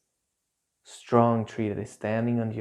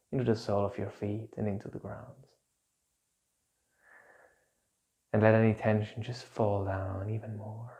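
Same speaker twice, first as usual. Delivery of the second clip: calm grounding exercise. Acted. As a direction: gently guide them to let tension drain. Leads a grounding meditation.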